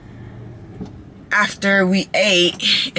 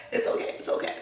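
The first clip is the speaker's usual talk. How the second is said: whispered; 13 dB quieter, faster than usual. Real, not acted. Repeats reassurance, now whispered.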